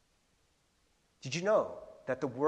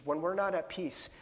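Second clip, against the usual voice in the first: ordinary delivery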